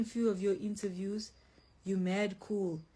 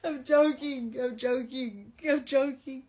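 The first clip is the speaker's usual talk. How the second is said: high, louder, laughing through it; 5 semitones higher, 4 dB louder than usual. Real, not acted. Laughing off her joke about eating men. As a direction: giggle out the denial.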